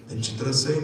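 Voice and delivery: ordinary delivery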